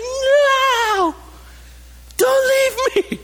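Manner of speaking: desperate tearful scream, voice shooting up high; child crying out. Retold or imitated. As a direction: cry out like a child left at daycare. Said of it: "they cry out for you".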